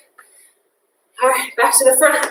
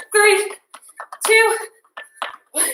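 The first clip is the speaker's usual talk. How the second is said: energetic countdown; 7 semitones higher, slower than usual. Real, not acted. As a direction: count them home with energy. Countdown at end of set.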